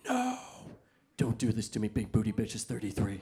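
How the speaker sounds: low, soft pleading under the breath; hushed dread. Retold or imitated. Worried interviewer pleading with the client, soft and low. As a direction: beg quietly, fearing the worst.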